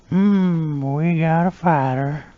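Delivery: put-on high, slow creepy old-man purr; Herbert. Herbert answering the paper boy, high and slow. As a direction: savour it like a leering old man. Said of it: "And he's".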